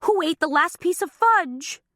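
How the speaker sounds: drawn-out high lament; wailing. Acted. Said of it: "she wailed".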